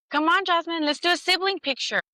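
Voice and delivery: a sister's bright, high call; cheerful sister. Retold or imitated. Sister calls Jasmine over for a photo, high and cheerful. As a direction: chirp the invitation up high and cheerful.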